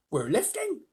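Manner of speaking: mild high-pitched call; shouted. A narrator's reading. Someone noticing the peach move, muted excited shout. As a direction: call out in rising surprise, without much volume. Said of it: "somebody shouted".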